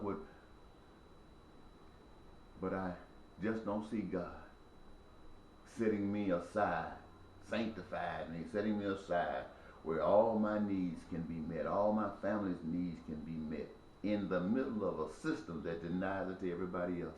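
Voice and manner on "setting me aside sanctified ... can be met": ordinary delivery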